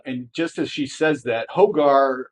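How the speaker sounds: normal talking voice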